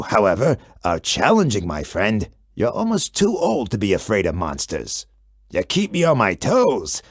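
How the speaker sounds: very low, rumbling monster voice; deep monster Gabe. A narrator's reading. Gabe the monster greets the kid, very low and rumbling. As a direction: speak from deep under the bed like a friendly beast.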